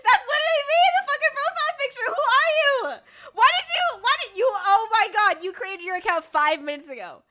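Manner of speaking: high, very loud, laughing outrage; incredulous shouting. Real, not acted. Streamer confronting a follower who copied their profile picture, incredulous shout. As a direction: yell in amused disbelief.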